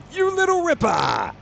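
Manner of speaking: very high, loud exclamation; shouted Aussie catchphrase. Shown as a demonstration. Showing off an Australian phrase, high excited shout. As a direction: belt out an Aussie cheer. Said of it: "I've got that".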